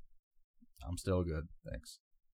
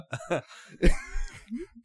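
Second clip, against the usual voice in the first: tearful laugh; 7 dB louder than usual. Real, not acted. Tearful laugh about brother.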